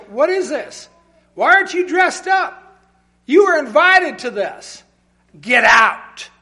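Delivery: rising to a harsh shout; angry king ordering a guest out; king's angry rebuke. Retold or imitated. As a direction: throw him out of the feast. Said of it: "The king asked him".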